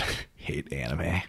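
breathy whispered groan; whispering. Acted. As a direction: whisper it in mock despair. Mock despair aside.

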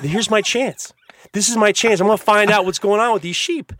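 high, loud mock-excited resolve; eager inner thought. Boy sees his chance to ask, high and eager. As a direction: seize the moment like an eager kid. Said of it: "and I thought".

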